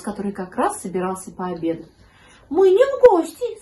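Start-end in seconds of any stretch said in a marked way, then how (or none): 2.5-3.6 high, loud kitten voice; high-pitched kitten objecting. A narrator's reading. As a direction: answer as a piping little kitten. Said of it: "сказал котёнок".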